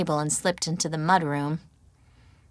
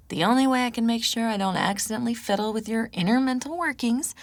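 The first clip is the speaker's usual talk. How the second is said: higher, wry teasing woman's voice; teasing Chris; 5 semitones higher, faster than usual. A narrator's reading. Chris teasing the grumpy narrator, higher playful voice. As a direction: tease her, hands up, mock-calming.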